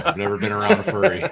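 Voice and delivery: high, loud, cracking up mid-line; laughing. Real, not acted. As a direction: laugh through the denial. Joking denial, laughing.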